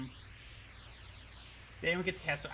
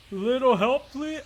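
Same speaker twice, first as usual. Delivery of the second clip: loud, high cartoon character voice; goofy put-on call for help; Trapper; 6 semitones higher, 7 dB louder, slower than usual. Shown as a demonstration. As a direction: push it up and out, cartoonishly. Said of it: "that would be like Trapper".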